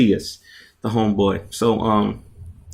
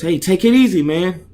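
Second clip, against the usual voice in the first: pleading shout; 8 semitones higher, 4 dB louder than usual. Acted. Opponent pleads in arm lock.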